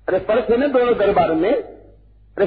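usual speaking voice

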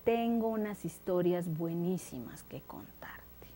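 soft conspiratorial whisper; whispered offer. Acted. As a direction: lure him in with a whisper. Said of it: "Ulises le dijo". (susurro tentador)

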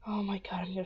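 breathless whispered oh my god. Real, not acted. Player whispering in shock over Astrid.

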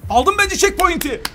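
high, loud, stretched cheer; slow, triumphant call; excited relief. Real, not acted. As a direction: burst out after the suspense. Checkpoint reached after suspense.

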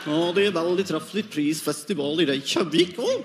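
sing-song mock Norwegian with rising "å". Mocking Norwegian speech for the crowd, sing-song mock Norwegian. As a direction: play a chirpy Norwegian. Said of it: "It's like".

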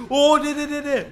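high, loud frantic protest; panicked outburst. Real, not acted. Player panicking as the preacher gets thrown, loud frantic cries. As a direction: shout in alarm as the fight turns. Panicked during fight scene.

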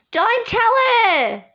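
high, loud, slow gasp then plea; gasped urgent plea. Acted. Girl begs Andrew not to tell, gasped high shout. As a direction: gasp and beg them loud.

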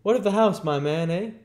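loud, high, insistent question; bustling doctor. Acted. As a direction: press the boy as an excited doctor.

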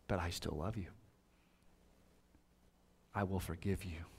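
gentle, sinking to a whisper at the end; tender forgiving words, a touch of Jesus; voicing Jesus' gaze. Preacher voicing Jesus to Peter, tender and hushed. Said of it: "a look that probably said something like this".

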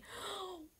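high, soft sharp intake of breath; shocked gasp. Retold or imitated. Reacting to the duck egg explanation, re-enacted shocked gasp. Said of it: "ってなっちゃって".

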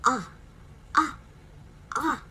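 ah said open and short, three times; short ah sound. Shown as a demonstration. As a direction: model the ah for the class, mouth open. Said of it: "The first sound is".

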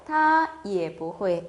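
each syllable of 会不会 stretched slowly; slow model reading. Shown as a demonstration. Teacher modelling the question for students, slow. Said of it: "그녀도 할 줄 몰라요".

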